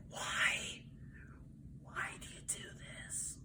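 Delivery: hushed, voiceless disbelief; barely audible, stunned question; horrified whisper. Retold or imitated. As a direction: whisper it as if appalled by the discovery. Horrified question in stolen-photo room.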